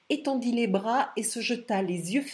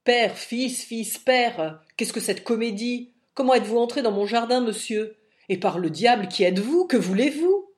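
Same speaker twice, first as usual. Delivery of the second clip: loud, angry mocking bark; angry old man swearing at intruder; furious outburst; 2 semitones higher, 8 dB louder than usual. A narrator's reading. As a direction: explode at the intruder, louder and harsher. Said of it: "explosion de jurons formidables".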